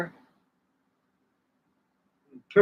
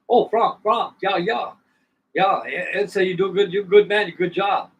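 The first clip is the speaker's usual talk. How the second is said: higher-pitched, louder imitation of a German man; German accent; 6 semitones higher, 4 dB louder, slower than usual. German brother greeting Frank on the phone, imitated German accent.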